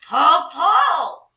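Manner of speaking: loud, high troll cry. Acted. Troll orders the girl to pull, loud and high. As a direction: shout it like a greedy troll. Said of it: "she cried".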